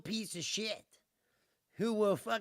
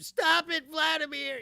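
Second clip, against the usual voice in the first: loud, higher mock scolding; all caps tweet; 7 semitones higher, 6 dB louder than usual. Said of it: "the all caps tweet".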